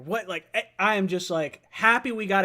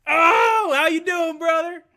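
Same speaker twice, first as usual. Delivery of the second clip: high, loud shout of welcome; excited hollered greeting; 9 semitones higher, 5 dB louder, slower than usual. Real, not acted. Host greeting the next caller, excited shout. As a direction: holler hello, thrilled to hear them.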